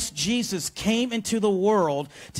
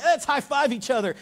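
mock giddy, higher voice; giddy high-five; 8 semitones higher, 3 dB louder than usual. Disciples celebrating together, mock giddy and higher. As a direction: hype each other up like kids. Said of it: "And they're like".

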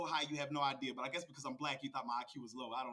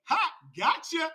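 loud, high burst of triumph; triumphant gotcha; 10 semitones higher, 10 dB louder, slower than usual. Real, not acted. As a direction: crow it like you caught them out. Triumphant gotcha at his rival.

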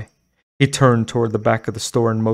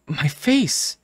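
horrified cry; 6 semitones higher than usual. A narrator's reading. Horrified cry at her reflection.

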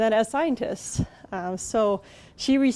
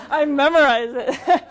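laughing through a sheepish joke; 5 semitones higher, 4 dB louder, slower than usual. Real, not acted. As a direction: chuckle your way through the words, slower. Joking about knowing her bio.

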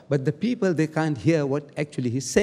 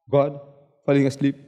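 normal talking voice

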